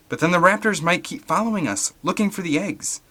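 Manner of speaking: put-on high boyish worry; a kid fretting; young worried voice.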